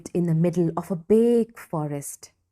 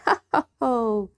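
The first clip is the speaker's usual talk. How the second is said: big smug laugh; gloating ho ho ho; 4 semitones higher than usual. A narrator's reading. Sid gloating as he stomps away. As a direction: chuckle to yourself, pleased.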